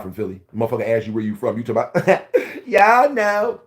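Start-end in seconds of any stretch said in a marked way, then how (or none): 2.7-3.6 high, loud laughing mock of a fake rapper. Mocking rappers who fake being from Philly, high and laughing. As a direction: squeak it out like a poser.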